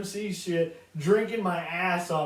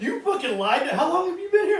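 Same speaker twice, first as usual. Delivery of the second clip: high, loud, laughing outrage; outraged bartender; 11 semitones higher, 6 dB louder than usual. Retold or imitated. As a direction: shriek it while laughing. Bartender voiced in story.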